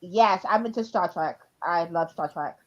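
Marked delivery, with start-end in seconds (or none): none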